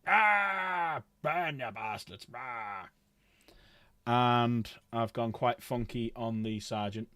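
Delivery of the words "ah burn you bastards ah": mock screaming. Streamer showing viewers the Pyre Cannon model's character, mock screaming. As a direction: imitate a crazed shouter without going full volume. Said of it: "crazy guy shouting and screaming".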